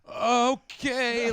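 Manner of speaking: high, loud, drawn-out okay; thrown host. Retold or imitated. Host learning the bio is wrong, awkwardly stalling.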